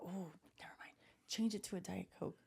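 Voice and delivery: unvoiced whisper, a secret correction. To her husband at the drive-through window, a whispered correction. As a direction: hush it so only he hears.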